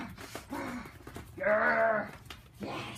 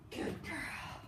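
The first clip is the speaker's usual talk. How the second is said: whispered praise; 15 dB quieter than usual. Real, not acted. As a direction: breathe it softly to the dog.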